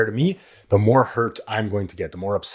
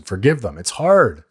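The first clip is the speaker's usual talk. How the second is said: ordinary talk, nothing marked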